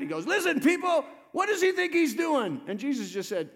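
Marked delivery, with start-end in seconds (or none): 0.3-2.6 high, loud outraged protest; mad synagogue leader. Retold or imitated. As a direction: yell at the crowd in indignation. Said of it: "And so he got mad".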